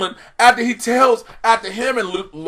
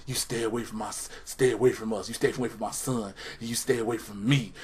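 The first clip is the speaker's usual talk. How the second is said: much lower, softer stern wife; lightly mocked wife; 11 semitones lower, 13 dB quieter than usual. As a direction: hiss the warning low. Wife voiced in recap.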